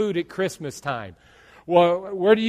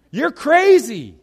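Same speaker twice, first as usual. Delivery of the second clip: mock incredulous shout; 7 semitones higher, 7 dB louder, slower than usual. Critic mocking.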